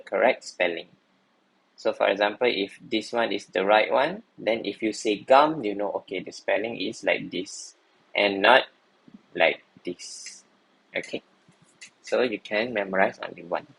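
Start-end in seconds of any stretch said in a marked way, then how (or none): none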